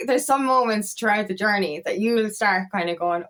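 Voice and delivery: ordinary talk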